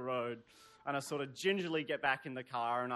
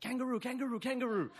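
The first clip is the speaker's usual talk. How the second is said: put-on high, squealing kid chant of kangaroo; excited kids; 9 semitones higher, faster than usual. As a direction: chant it like thrilled little kids spotting a kangaroo. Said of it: "really excited to be here".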